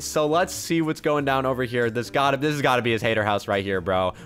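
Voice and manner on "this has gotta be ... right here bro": ordinary talk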